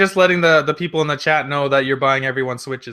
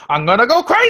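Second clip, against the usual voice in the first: high, loud burst of hype; overjoyed, raised-voice outburst; excited yell; 8 semitones higher, 7 dB louder than usual. Real, not acted. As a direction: shout it like a thrilled fan. Hyped about a hoped-for sequel.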